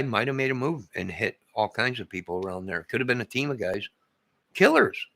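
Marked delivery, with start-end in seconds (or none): 4.5-5.1 high, loud outburst; Killers. shouted high and loud; dramatic shout. Acted. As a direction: blurt it out like a shock reveal. Dramatic exclamation, then repeated.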